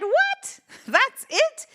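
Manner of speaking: What? shot up high and loud; incredulous, as if he can't believe it; astonished outburst. Acted. Man's outraged disbelief.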